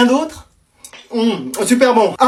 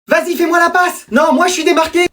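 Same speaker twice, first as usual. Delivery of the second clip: high-pitched calls across a game; excited high calling; calling for ball; 7 semitones higher than usual. Acted. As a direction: call out eagerly to a teammate.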